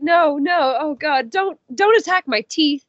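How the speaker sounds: imitating a desperate prisoner, half in jest; mock pleading. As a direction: retell Han's panic as a playful plea. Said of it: "he's just like".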